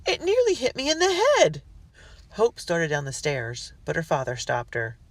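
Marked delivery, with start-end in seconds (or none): 0.1-1.6 high, loud, upset cook; alarmed cry. A narrator's reading. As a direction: cry out in fright. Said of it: "Cook cried".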